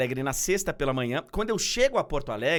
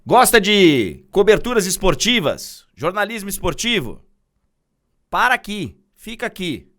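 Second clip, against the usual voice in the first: loud, punchy promo read over music; promo announcer; 4 semitones higher, 15 dB louder than usual. Acted. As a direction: sell the show like a TV teaser.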